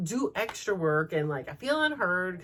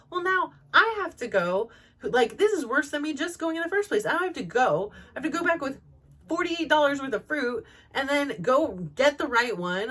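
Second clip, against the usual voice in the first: high, fed-up rant; mildly imitating her own frustration; a put-upon whine of a retort; exasperated complaint; 7 semitones higher, 3 dB louder than usual. Her frustrated reply retold.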